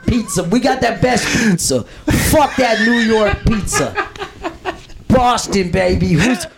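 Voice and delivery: high, hammed-up Boston accent. Shown as a demonstration. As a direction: play a brash Boston local bragging. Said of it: "how do you do a Boston accent".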